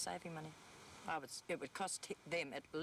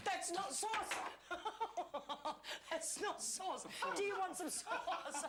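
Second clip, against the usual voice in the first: laughing; 12 semitones higher, much slower than usual. Real, not acted. Laughing at sleepy husband's mistake.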